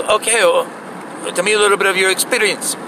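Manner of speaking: lightly mocking manager voice; imitating the store lady. Store lady voiced, higher and unlike own.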